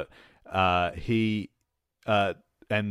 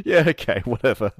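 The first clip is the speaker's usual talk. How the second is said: dismissive shrug; 4 semitones higher, 9 dB louder than usual. Retold or imitated. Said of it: "And it's like".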